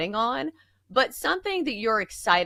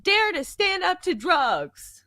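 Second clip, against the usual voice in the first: high, slow, sing-song slogan voice; mock slogan; 10 semitones higher, slower than usual. Co-host mocking the school anti-drug slogan, sing-song. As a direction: recite it like a corny campaign chant. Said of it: "it was like".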